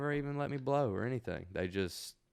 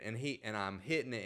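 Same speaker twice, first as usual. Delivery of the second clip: normal talking voice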